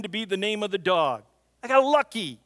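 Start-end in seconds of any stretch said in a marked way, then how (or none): 1.6-2.4 louder and higher, laughing at himself; cheerful confession; playful exclamation. Real, not acted. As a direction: toss it off brightly. Comic admission after story.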